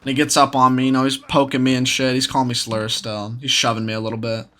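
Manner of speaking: ordinary delivery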